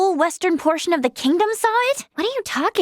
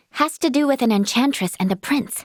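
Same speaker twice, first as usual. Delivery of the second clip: usual speaking voice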